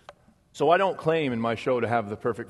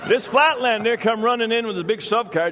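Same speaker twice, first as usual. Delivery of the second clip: Vermont logger retelling it higher and louder over the laugh; louder retake; 9 semitones higher, 5 dB louder than usual. Acted. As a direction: punch it again, bigger, riding the audience laugh.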